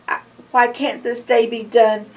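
ordinary delivery, nothing marked

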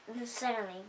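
usual speaking voice

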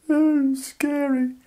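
high, slow frightened squeak; scared child. Acted. UK as child afraid of jumping, high scared squeak. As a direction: squeak it like a scared little kid.

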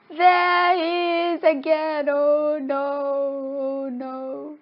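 high, drawn-out dread; high-pitched mock panic; acted fright. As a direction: wail in exaggerated alarm, fading at the end. Ghost reappears in the story.

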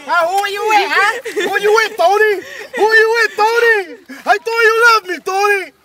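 high, hammed-up jealous lover; acted confrontation. Acted scene, cheating partner confronted.

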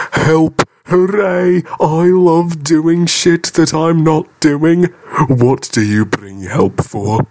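excited engine. Acted. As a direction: cheer like an overjoyed engine. James cheers at the offer.

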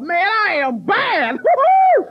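slow boast rising to high whoop; cocky exultation. Acted. Standing before all these people, cocky and gleeful. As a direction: revel loudly, then squeal with glee.

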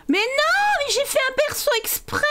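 high, indignant mais non; indignant protest. Real, not acted. Streamer protesting to the game about social services. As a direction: squeak out the outrage.

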